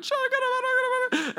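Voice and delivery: high-pitched rapid babble; mock tongues-praying. Imitating a Korean woman praying in tongues behind him, high fervent babble. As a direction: go for it in nonsense syllables. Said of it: "She's crying and countering".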